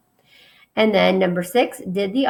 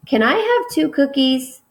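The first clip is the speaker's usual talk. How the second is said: asking; 6 semitones higher, 3 dB louder than usual. Acted. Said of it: "I asked".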